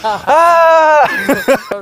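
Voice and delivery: high imitated scream. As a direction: mimic the friend's loud yell. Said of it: "I never heard you s- scream so loud".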